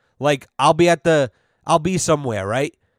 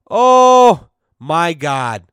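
long, loud, stretched-out "Oh"; drawn-out exclamation; 8 dB louder, much slower than usual. Real, not acted. Prankster reliving the hilarious moment, drawn-out exclamation. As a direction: savour it very slowly and loudly. Savouring his own prank story.

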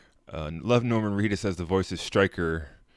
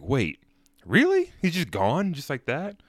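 surprised; 7 semitones higher than usual. Retold or imitated. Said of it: "It's like".

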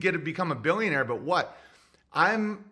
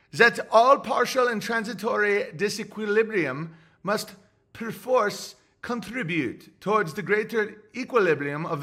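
French accent, higher, hammed-up quoting a philosopher; mock French accent; 5 semitones higher than usual. Reading a fan's Guénon quote aloud, mock French accent. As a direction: read the quote as a cartoon Frenchman. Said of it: "Born and raised in Lyon".

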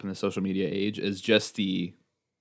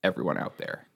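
soft intimate address; 8 dB quieter than usual. Acted. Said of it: "I would also like to add".

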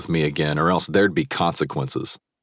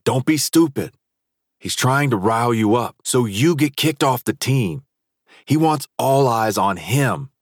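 urgent teammate; 7 semitones higher than usual. A narrator's reading. Teammate restraining him.

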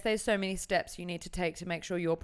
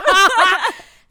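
big high burst of laughter; loud laugh; 11 semitones higher, 17 dB louder than usual. Real, not acted. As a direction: crack up loudly. Laughs at joke.

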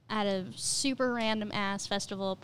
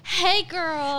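put-on louder, higher "hey, girl"; playful sassy greeting, hammed up; sassy body-part voice; 6 semitones higher, 5 dB louder than usual. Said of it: "your vagina's like".

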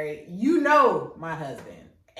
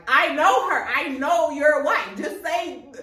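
exasperated shout; 8 semitones higher, 8 dB louder than usual. Retold or imitated.

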